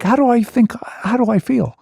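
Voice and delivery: ordinary delivery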